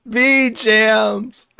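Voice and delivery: high, loud laughing "ah, bee jams"; laughing mock scream. [name] screaming at chat about the bees. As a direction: cackle it out as a yell.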